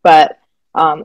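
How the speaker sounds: normal talking voice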